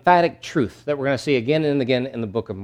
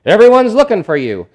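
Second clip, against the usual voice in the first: exclaiming; 8 semitones higher, 9 dB louder than usual. Retold or imitated. Disciples exclaim finding Jesus.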